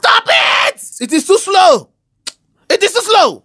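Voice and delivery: high-pitched, exploding yell; exasperated shout. Acted. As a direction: yell in loss of patience, pitch shooting up. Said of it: "Please, don't shout on me".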